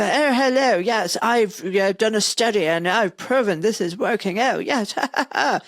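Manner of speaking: high-pitched, mock-posh academic; hammed-up posh toff with a self-satisfied chuckle; posh English professor. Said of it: "You know, this posh English person".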